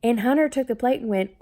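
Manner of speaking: normal talking voice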